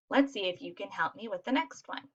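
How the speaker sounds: ordinary delivery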